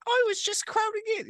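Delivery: very high squeaky character voice; Patrick Jackson voice. Shown as a demonstration. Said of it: "He's still doing the Patrick Jackson".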